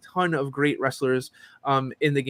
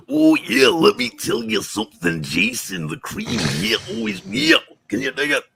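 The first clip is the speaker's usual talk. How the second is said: Macho Man Randy Savage; 6 semitones higher, 4 dB louder than usual. Shown as a demonstration. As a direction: growl and whoop it like a showboating wrestler. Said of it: "can you do a good Macho Man".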